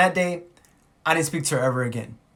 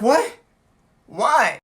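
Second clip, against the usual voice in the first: high, loud startled questions; shocked; 7 semitones higher, 5 dB louder than usual. Retold or imitated. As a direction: demand an answer in disbelief. Reacting to news she was fired.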